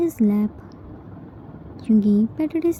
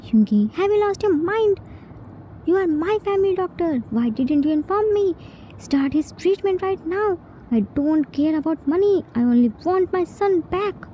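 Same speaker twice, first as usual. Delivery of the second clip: higher, fast and insistent; angry demand; 7 semitones higher than usual. Acted. Yoongi rebuking the family doctor, high and fast with anger. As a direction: scold the doctor and demand action now.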